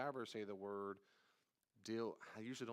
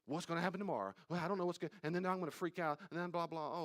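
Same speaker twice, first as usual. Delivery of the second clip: higher and louder, a hammed-up anxious rush; mock panicked worrier; 6 semitones higher, 4 dB louder, faster than usual. Anxious person spiraling about tomorrow, mock panic. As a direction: imitate a worrier working themselves into a frenzy.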